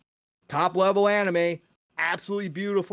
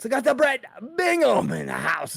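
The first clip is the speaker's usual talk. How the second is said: high, shouted announcement; hyped shout-out; 8 semitones higher, 4 dB louder than usual. Real, not acted. Host announcing a viewer's arrival, hyped shout. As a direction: belt out the name like an announcer.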